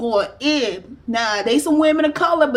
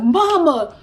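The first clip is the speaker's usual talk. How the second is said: put-on excited child; 4 semitones higher, 3 dB louder, slower than usual. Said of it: "My baby's like".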